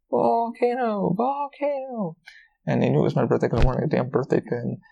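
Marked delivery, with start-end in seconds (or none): 0.1-2.2 high, slow drawn-out volcano call; mock restaurant announcer chant, drawn out and high; staff yelling volcano. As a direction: stretch it out like restaurant staff hyping a show. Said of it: "they kept screaming, uh, the word".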